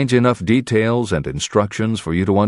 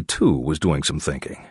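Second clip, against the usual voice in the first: ordinary delivery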